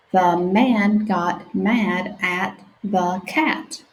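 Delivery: stretched-out sounding out; slow sounding out. Shown as a demonstration. Teacher reading slowly with children. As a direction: read each word slowly for beginners. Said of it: "let's practice together".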